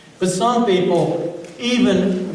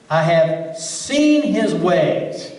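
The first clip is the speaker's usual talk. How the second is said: slow solemn quote; much slower than usual. Acted. Quoting God's words in verse.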